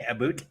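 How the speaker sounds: "about" said like "aboot"; soft, questioning "about" with a Canadian oo. Shown as a demonstration. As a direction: say "about" with an oo vowel, rising as a question. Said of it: "it's the about that gives it away".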